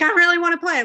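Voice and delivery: high, loud burst of eagerness; eager outburst. Retold or imitated. Musician acting out her urge to audition, eager outburst.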